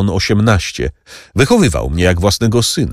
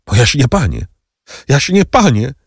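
terrified plea; 5 semitones higher than usual. A narrator's reading. Steward pleading with his master, frightened and rising. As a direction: cry out in fear, pleading higher on the repeat. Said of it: "zawołał intendent, przerażony tą groźbą".

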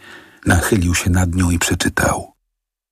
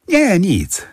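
flustered denial; 13 semitones higher than usual. A narrator's reading. Kowalski caught, evasive.

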